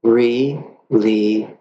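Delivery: stretched out slowly on the out breath; slow letting go. Shown as a demonstration. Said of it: "we would intend".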